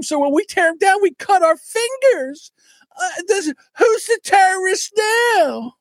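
very high, tearful mock whine; hammed-up sobbing complaint, shouted at the end; mocking crybaby impression. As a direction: wail it like a crybaby, mocking a ranter. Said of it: "That's crybaby".

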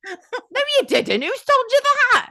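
high laughing voice; laughing while quoting. Real, not acted. Quoting the nurse's reply, high laughing voice. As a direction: quote the nurse amid giggles.

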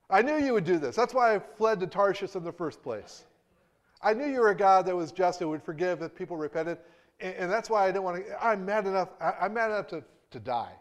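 loud, high angry outburst; furious rant. Acted. Jonah furiously accusing God, loud and high. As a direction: shout your grievance at God. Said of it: "Jonah got mad".

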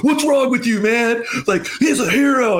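high, whiny mock outrage, laughing through it; mocking normies. Retelling his ex's friends' reaction, mocking them in a high whiny voice. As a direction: squeak it out like a scandalized brunch guest.

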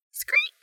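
very high, short squeak; tiny high-pitched "screet"; bat screech. A narrator's reading. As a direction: give a piping little bat call. Bat calls to his friend.

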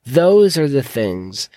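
usual speaking voice

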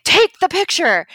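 imitated impatient yell, high and loud. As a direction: yell it as an order. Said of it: "had really just yelled at me".

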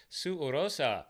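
"Orosa" said with a trilled, rolled R. Real, not acted. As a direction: roll the R in "Orosa" with a flick of the tongue. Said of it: "I like how you pronounce my name".

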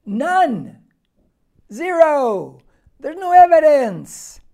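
booming, drawn-out emphatic verdict; emphatic preaching. Real, not acted. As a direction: hammer each answer home at full volume. Preacher hammering home the answer.

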